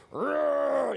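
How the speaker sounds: loud, high strained roar; mock weightlifter roar. Weightlifter straining at the gym, loud roar. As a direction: roar like lifting a heavy bar. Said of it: "people lifting weights".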